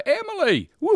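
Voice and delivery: high, loud birthday whoop; excited cheer. Real, not acted. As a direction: cheer her name out. Host cheers birthday on air.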